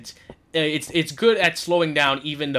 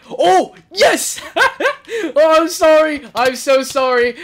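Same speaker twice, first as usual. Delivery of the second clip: high, loud yes breaking into laughter; laughing, gloating apology; gleeful triumph; 10 semitones higher, 9 dB louder, slower than usual. Real, not acted.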